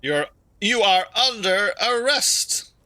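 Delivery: loud, high mock arrest; excited shout. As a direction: bark out the arrest gleefully. Playfully arresting the driver.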